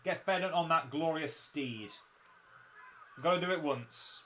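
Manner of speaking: normal talking voice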